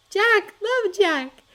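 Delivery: put-on high, delighted small-girl voice; a little girl squealing with joy; little sister. A narrator's reading. Small sister swung around by her brother, high and delighted.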